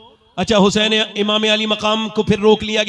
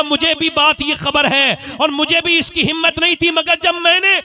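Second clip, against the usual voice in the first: preaching at full force; 7 semitones higher than usual. Real, not acted. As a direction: thunder the last line to the crowd. Oratory rising to shouted climax.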